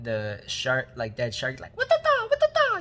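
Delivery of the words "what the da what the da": very high, loud falsetto squeals; hammed-up freak-out in a squeaky falsetto; mock freak-out. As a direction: squeal it loudly, imitating someone flipping out. Mimics Oscar flipping out, kicking shark.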